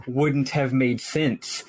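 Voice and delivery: slow, pointed air quotes; slow air-quoted phrase. Retold or imitated. Quoting the game's excuse, slow and pointed. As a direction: drag it out like quoting the game. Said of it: "quote, end quote".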